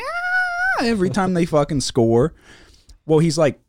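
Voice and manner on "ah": mock kid's squeal, very high; high-pitched kid scream. Kid fan cheering Kansas baskets, mock high squeal. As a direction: imitate a young fan squealing at a score. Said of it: "got this high-pitched voice and he's screaming".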